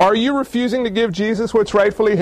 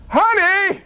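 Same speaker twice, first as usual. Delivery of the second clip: high-pitched, astonished call; stunned calling out; 10 semitones higher than usual. Acted. Stunned neighbor calling a spouse to the window, high astonished call.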